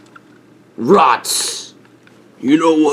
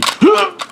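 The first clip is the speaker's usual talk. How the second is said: hiccup. Acted. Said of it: "Did it work?".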